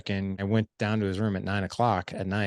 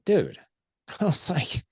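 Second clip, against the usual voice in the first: higher, exasperated outburst; pissed off; 6 semitones higher, slower than usual. Retold or imitated. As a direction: retell it as a pissed-off dad. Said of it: "And I got pissed".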